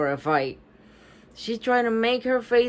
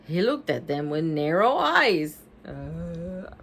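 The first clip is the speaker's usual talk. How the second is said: plain reading voice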